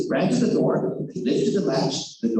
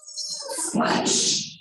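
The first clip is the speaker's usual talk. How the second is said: splash. Acted. Wolf falls in river.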